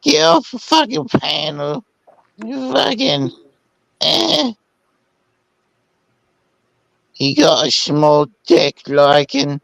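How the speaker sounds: high, put-on ranting voice; angry Meg impression. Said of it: "sounds like this".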